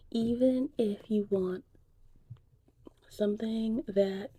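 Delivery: normal talking voice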